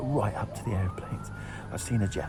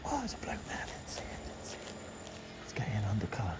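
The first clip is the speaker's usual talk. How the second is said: whispering; 8 dB quieter than usual. Real, not acted. Startled by guard, hiding.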